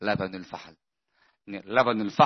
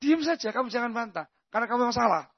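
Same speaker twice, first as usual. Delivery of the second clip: firm scolding; 13 semitones higher, 3 dB quieter than usual. Real, not acted. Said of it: "Tapi kadang orang kan jengkel".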